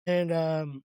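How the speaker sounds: usual speaking voice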